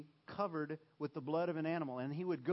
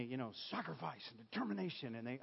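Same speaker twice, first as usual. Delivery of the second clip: ordinary talk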